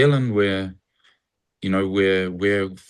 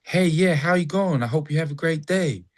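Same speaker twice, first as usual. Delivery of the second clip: high, bright-eyed enthusiastic hello; eager American greeting; 7 semitones higher than usual. Retold or imitated. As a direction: greet like you truly mean it, pitched well up. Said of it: "they like".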